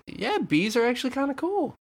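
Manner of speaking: high-pitched imitation of students; won-over students. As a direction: put on a high student voice, conceding. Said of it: "people were like".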